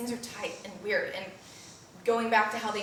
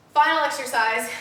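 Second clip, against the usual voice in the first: winded after talking; a bit breathless; out of breath; 4 semitones higher, 8 dB louder than usual. Real, not acted. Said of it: "I'm very out of breath".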